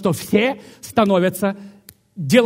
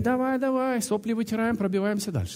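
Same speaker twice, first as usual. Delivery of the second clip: soft, raised-pitch coaxing voice; God coaxing; 6 semitones higher, 5 dB quieter than usual. Acted. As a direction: gently egg on a sniveller, acting it out. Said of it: "А Бог говорит".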